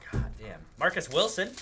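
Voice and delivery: ordinary talk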